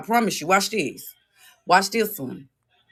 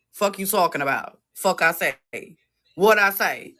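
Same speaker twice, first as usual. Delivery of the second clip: usual speaking voice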